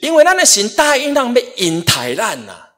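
high, loud preacher's exhortation; forceful preaching. Real, not acted. Preaches with raised voice.

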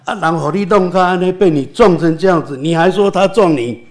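retold angry gang boss yelling at him; furious boss. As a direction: act out the boss shouting in disbelief. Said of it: "他生气得不得了".

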